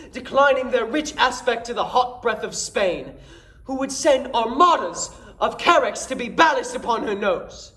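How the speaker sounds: horrified comic complaint, punching the key words; comically aghast Dromio. Acted. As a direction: play to the house with big swoops and pauses for each joke. Performs Dromio's comic Shakespeare monologue.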